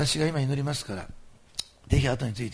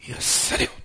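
loud command; 5 dB louder, slower than usual. Real, not acted. Healing command in prayer.

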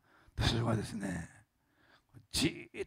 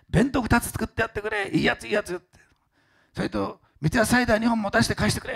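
higher, louder orders; boss giving orders; 10 semitones higher, 5 dB louder than usual. Retold or imitated. President telling the geisha to pack bento boxes, commanding. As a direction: hand out orders like a generous boss.

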